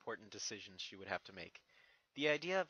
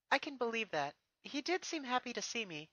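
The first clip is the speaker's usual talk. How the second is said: fond princess; 11 semitones higher than usual. Acted. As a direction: pour out how much you missed him. Twilight replies.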